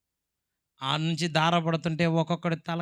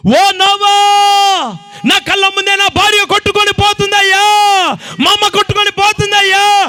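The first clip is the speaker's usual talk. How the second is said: much higher and louder, crying out in terror; desperate pleading shout; 17 dB louder than usual. Acted. Drowning people beg Noah to open the door, frantic crying screams. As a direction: scream for the door to open as the flood takes them. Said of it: "చేతులు చాపి కేకలేస్తున్న కేక".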